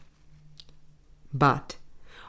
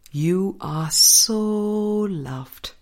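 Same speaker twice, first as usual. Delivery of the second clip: tender reassurance; much slower than usual. Real, not acted. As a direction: let each word land gently, like comforting someone. Answer comforting a desperate writer.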